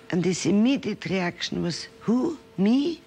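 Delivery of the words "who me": put-on a child amazed he is the one chosen; surprised disbelief. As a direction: voice jumps high on a startled "Who? Me?". Boy surprised at being singled out.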